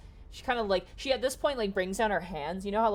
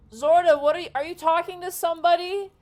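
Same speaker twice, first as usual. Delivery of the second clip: high, loud calling voice; 14 semitones higher, 12 dB louder than usual. Acted. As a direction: shout from down the tunnel to her friend. Another giant calling out.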